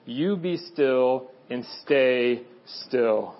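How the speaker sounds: low, slow, stern order; stern command. Retold or imitated. Parent to an out-of-control little child, stern command. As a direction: lay down the law slowly, as to an unruly child. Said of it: "Look at them sternly in the eye and say".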